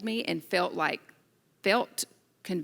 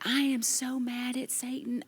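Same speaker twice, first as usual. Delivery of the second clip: higher, half-hearted mock anger; imitating herself fuming, lightly; mock mad; 6 semitones higher, 4 dB quieter than usual. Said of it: "And I'm like".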